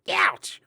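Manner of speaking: sharp high ouch; sudden yelp. Acted. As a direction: yelp as you're hit. Hit by the haversack.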